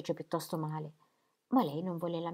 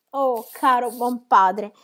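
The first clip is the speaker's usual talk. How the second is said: slow, much louder joyful cry; a daughter overjoyed, hugging her father; joyful exclamation; 7 semitones higher, 13 dB louder, much slower than usual. A narrator's reading. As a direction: exclaim it with arms flung round him. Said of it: "esclamò Mary".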